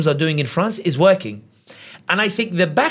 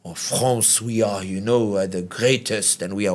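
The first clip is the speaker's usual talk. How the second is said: mock French pride; 7 semitones lower, 4 dB quieter than usual. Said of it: "because the French have that".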